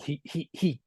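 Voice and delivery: ordinary talk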